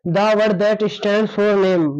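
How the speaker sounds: very slow, every word drawn out; slow dictation. Shown as a demonstration. Teacher repeating the definition for notes, very slowly. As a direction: dictate so they can copy. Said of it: "repeats definition for writing".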